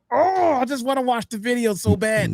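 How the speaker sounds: high-pitched mock longing; yearning self-quote. Streamer retells longing to click a video, high mock yearning. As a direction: retell your own itch in a high whine. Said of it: "I'm like".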